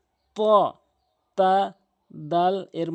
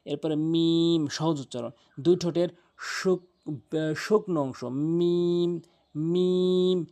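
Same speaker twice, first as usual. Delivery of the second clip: ordinary talk, nothing marked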